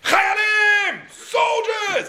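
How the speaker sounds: rally the troops with a roaring call; general screaming. Retold or imitated. General on the box screaming at his soldiers, loud and high. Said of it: "he'll scream".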